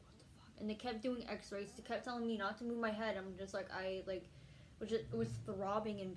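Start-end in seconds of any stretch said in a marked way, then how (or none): 0.1-0.6 breathless whispered curse; a whisper of confusion; dazed whisper. Retold or imitated. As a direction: barely voice it, out of it. Dazed in hospital.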